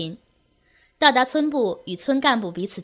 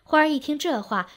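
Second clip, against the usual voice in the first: usual speaking voice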